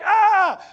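a high, loud startled "Ah!"; mock scream. Young houseparent startled by the screaming boy, mock scream. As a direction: shriek in fake alarm. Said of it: "I'm like".

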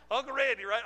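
mock-emphatic name call; playful jab. Real, not acted. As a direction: rib Uncle Randy playfully. Joking about Uncle Randy.

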